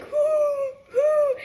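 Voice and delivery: high-pitched fake wailing sobs; mock crying. Dreamer crying before the horror guest arrives, high mock wail. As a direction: wail "hoo" high, badly faked crying. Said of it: "crying, I'm like".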